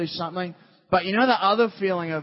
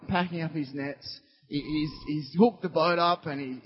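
ordinary talk, nothing marked

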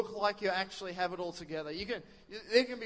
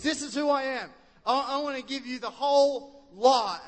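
booming, raised divine declaration; God answering the worried believer; 5 semitones higher, 7 dB louder than usual. Retold or imitated. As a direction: proclaim it grandly as God. Voicing God speaking.

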